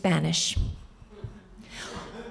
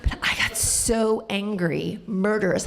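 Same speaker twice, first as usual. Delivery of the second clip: usual speaking voice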